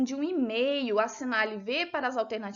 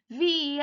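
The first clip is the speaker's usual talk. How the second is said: "viagem" with the A stretched long and louder; stress on A; 3 dB louder than usual. Shown as a demonstration. Teacher showing pupils the tonic syllable, stretching the A in "viagem". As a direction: draw out the middle A of "viagem". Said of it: "O A é a sílaba tônica".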